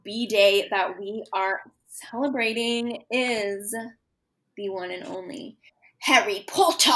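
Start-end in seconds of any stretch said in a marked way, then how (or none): 6.0-6.9 British accent. Shown as a demonstration. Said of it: "That was my Harry Potter accent".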